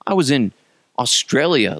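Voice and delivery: normal talking voice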